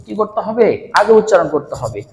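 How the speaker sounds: ordinary talk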